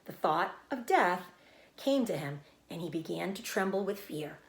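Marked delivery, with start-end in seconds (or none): none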